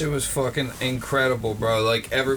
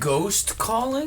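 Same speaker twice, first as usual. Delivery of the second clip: much higher, slow incredulous echo; mock disbelief; 12 semitones higher, much slower than usual. Real, not acted. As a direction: drag it out as if it's absurd. Repeats the dumb excuse mockingly.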